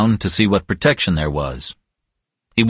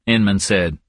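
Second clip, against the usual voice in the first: ordinary delivery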